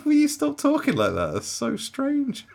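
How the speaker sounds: high, loud, exasperated; baffled plea. Retold or imitated. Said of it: "just going".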